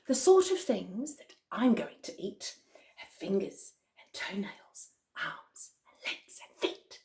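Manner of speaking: hushed, menacing whisper, fading away; wicked crocodile. Acted. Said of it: "Ooh, he sounds wicked".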